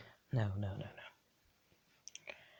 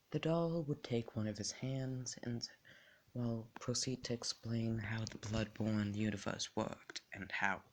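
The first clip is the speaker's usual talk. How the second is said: usual speaking voice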